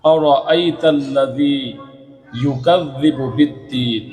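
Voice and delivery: slow, drawn-out tajweed chant; slow melodic Quran recitation. Shown as a demonstration. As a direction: recite each verse slowly with long drawn vowels. Recites Quran verses as examples.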